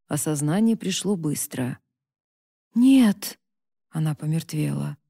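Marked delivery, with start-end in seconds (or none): 2.7-3.4 loud, high cry of horror; horrified cry. Acted. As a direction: recoil with a shout. Mother horrified by order.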